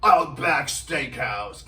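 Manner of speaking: loud, slow, stretched-out Aussie ad voice; Australian commercial announcer. Retold or imitated. Imitating the guy from the commercial, Australian announcer. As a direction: draw it out like an Australian advert tagline. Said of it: "a guy who sounded like him to do the commercial".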